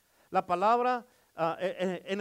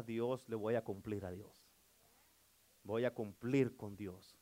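slow, softer promise to God; quiet sincere vow; 8 semitones lower, 6 dB quieter, slower than usual. Acted. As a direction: say it slowly, softly, like a personal vow. Preacher voicing a quiet vow. (promesa sincera a Dios, lenta y más suave)

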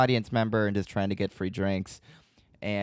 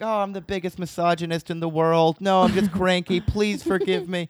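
high, loud mock-desperate apology; hammed-up grovelling; 10 semitones higher, 5 dB louder than usual. Bartender pleading with roommate after snubbing a comedian, hammed-up grovelling. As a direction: beg in an exaggerated, pitched-up panic. Acts out over-the-top begging apology.

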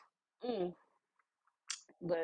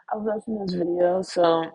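ordinary talk, nothing marked

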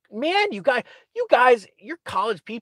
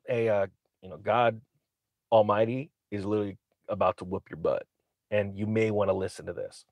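lower, softer offhand warning; mock casual Moses; 9 semitones lower, 8 dB quieter than usual. Moses casually warning Pharaoh, lower and softer. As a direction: play it as a laid-back threat.

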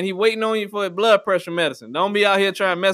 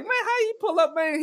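much higher, softer incredulous question; mock high disbelief; 9 semitones higher, 4 dB quieter than usual. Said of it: "I was like".

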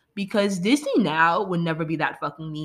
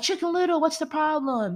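high-pitched imitated townsperson; imitating townspeople; 8 semitones higher than usual. Townsfolk who should have asked Chicken Little, high mock voice. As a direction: lightly act out a townsperson's question, pitched up.

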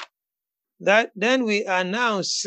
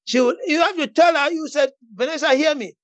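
raised, emphatic preacher's declaration; fervent preaching; 5 semitones higher than usual. Real, not acted. Preacher urging a friend to declare life over Vanessa, fervent preaching. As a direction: preach it with bold authority, pitched up.

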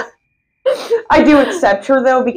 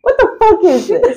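higher, laughing incredulous outburst; high-pitched disbelief; 10 semitones higher than usual. Real, not acted. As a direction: squeal the question through laughter. Repeats the stunned question, higher.

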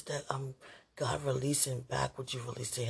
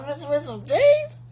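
mock wail; 11 semitones higher, 13 dB louder than usual. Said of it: "I said".

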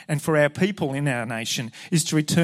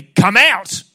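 loud, high commanding shout; stern command; 6 semitones higher, 15 dB louder than usual. Retold or imitated. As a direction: cast the demon out with full authority. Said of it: "he said sternly".

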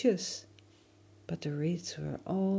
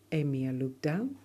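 ordinary delivery

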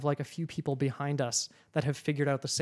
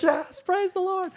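very high, squeezed cheer; acted-out high-pitched rejoicing; jubilant praise. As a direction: celebrate in a far higher voice. Retold celebration at getting seats.

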